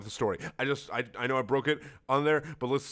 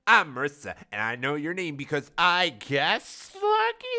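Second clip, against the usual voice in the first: mock girly voice; 4 semitones higher, 6 dB louder than usual. Marissa's reply, acted.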